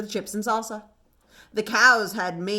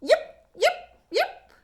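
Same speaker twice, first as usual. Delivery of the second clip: very high little fox yips; little fox calling out, very high; fox yipping; 12 semitones higher, slower than usual. A narrator's reading. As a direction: yip high like a small fox pup. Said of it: "She called".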